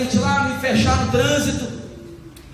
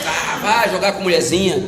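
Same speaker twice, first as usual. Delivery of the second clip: mocking friend; 8 semitones lower than usual.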